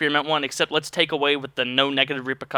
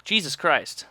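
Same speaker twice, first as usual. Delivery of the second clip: normal talking voice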